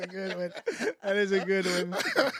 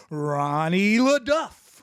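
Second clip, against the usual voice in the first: announcer call; 2 semitones higher, 5 dB louder, slower than usual. Acted. Host introduces co-host.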